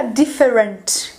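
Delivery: "different" in three syllables, dif-fe-RENT; wrong full E. Shown as a demonstration. Teacher showing learners what to avoid, "different" with full vowels. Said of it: "Então não vai ficar".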